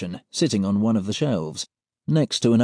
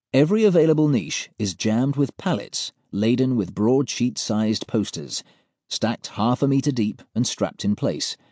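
usual speaking voice

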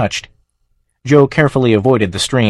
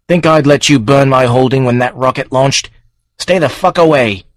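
louder, raised hostile warning; angry threat; 4 semitones higher, 4 dB louder than usual. Acted. Joe threatening the farm with his gun, loud angry warning. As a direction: back the monster off with force.

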